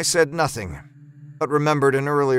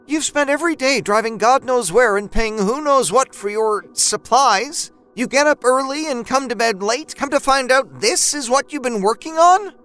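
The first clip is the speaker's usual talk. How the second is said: much higher and louder, berating; angry wife; 11 semitones higher, 4 dB louder than usual. A narrator's reading. Wife scolding her husband about money, high angry rant. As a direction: let her tirade build.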